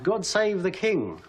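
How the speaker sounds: slow, high cheer, only half shouted; mock crowd cry. Common people hailing the king, retold as a mild mock cry. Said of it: "they're all screaming out".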